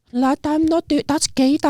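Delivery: younger self protesting. Retold or imitated. Lad voicing his younger self refusing in the changing room, squeaky. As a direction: brush it off like a flustered kid. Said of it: "I used to be like".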